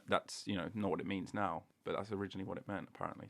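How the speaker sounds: ordinary talk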